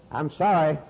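normal talking voice

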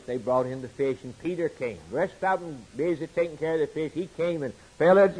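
ordinary talk, nothing marked

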